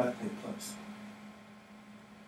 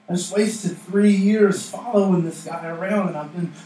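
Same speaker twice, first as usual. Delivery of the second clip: Peter in dismay; 4 semitones higher, 4 dB louder than usual. Acted. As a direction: act out dismay, pitch lifted, dragging the first words. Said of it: "you imagine Peter".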